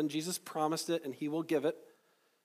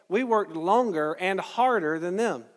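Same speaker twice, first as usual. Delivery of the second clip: ordinary talk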